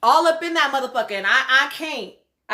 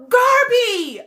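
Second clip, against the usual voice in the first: high, loud shout of her name; retold yell; 11 semitones higher, 6 dB louder than usual. Host mimics him yelling at Garby, loud shout. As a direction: bark the name at her like he did. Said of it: "when he yelled".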